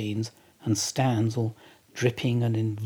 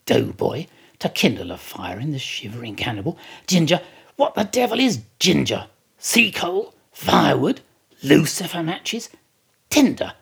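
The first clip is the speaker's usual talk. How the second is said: mock-calm outrage; 2 semitones higher, 5 dB louder than usual. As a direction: berate the steward with sarcastic questions. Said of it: "calmly walked ... slowly saying".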